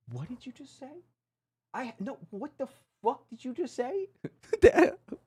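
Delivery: high, squeaky incredulous voice; stunned disbelief. Real, not acted. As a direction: start hushed and high, stunned by the accusation. Incredulous at an accusation of racism.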